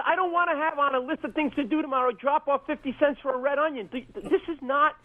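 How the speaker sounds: high, exasperated mock complaint; indignant protest. Comic refusing to come back with money, high exasperation.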